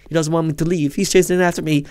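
ordinary talk

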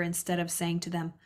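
normal talking voice

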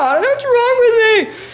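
high, exaggerated complaint; comic high-pitched whine; mock wail. Real, not acted. Exaggerated self-complaint to viewers.

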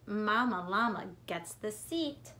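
plain reading voice

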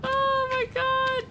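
high, loud laughing panic; panicked laughter. Real, not acted. Player fleeing back up the tunnel, high laughing panic. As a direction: laugh through the fear.

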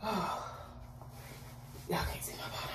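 breathy hushed aside; whisper. Real, not acted. Telling viewers on camera they can't see her bottom, whispered.

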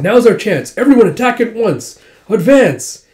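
loud, high rousing order; battle command. Retold or imitated. General to troops seizing their chance, rallying shout. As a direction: spur everyone into the charge.

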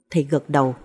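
ordinary delivery, nothing marked

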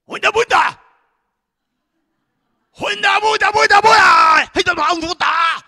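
high, full-force war cry in mock Maasai; warrior's yell. Preacher acts the warrior riling up the lion, yelling. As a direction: bellow to wake a sleeping lion. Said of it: "and he says".

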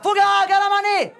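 high, loud mock chief's call; hammed-up chief's cry. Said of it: "dit le grand chef".

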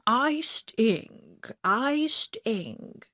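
lightly voiced king muttering to himself. A narrator's reading. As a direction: mumble it under his breath like a sulky king. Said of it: "the king was muttering to himself".

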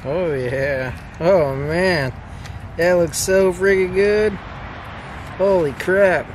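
ordinary talk, nothing marked

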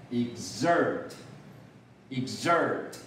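"exert" as ex-ERT, slow and drawn out; short ex, long ZERT in "exert"; slow model reading of "exert", weight on the end; stress on second syllable. Shown as a demonstration. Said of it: "也是在第二节".

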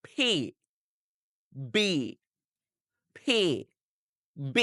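"P", "B" alternated with pauses, both from pressed lips; two-lip P and B. Shown as a demonstration. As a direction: drill "P" and "B" one by one off closed lips. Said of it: "দুই ঠোঁট চাপ দিয়ে". ("P", "B" দুই ঠোঁট চেপে থেমে থেমে)

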